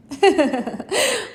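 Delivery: high laugh of giving in; hearty laugh. Acted. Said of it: "began to laugh".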